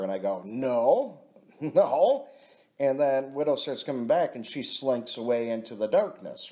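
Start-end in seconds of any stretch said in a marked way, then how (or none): 0.4-2.3 a loud, sharp no that breaks into a laugh; half-laughing angry no. Retold or imitated. To the friend asking about the tickets, an angry no with a laugh. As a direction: refuse her flat, then laugh at it. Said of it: "I went right to anger".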